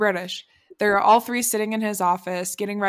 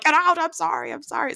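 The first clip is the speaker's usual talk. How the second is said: mock startled yelp, then apology; flustered retelling; 8 semitones higher than usual. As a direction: act out a flustered walk-in. Retold startled bathroom intrusion.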